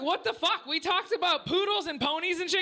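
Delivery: high-pitched baffled protest; indignant disbelief. Retold or imitated. Employee arguing back to the boss, mock indignant disbelief. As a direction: pitch it right up, protesting your innocence.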